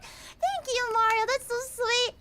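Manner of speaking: high-pitched thank you, that's so sweet; touched squeal. Real, not acted. Streamer thanking a fan for a compliment, gushing. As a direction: gush with delight.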